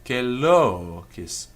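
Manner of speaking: "celocis" with a long rising-falling o; circumflex accent. Shown as a demonstration. Teacher giving another example, "celocis" with circumflex on lo. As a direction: swell on the middle syllable of "celocis". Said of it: "has got the circumflex accent".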